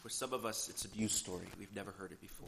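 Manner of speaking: soft, subdued prayer voice. Acted. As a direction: pray quietly on behalf of the congregation. Leads opening prayer.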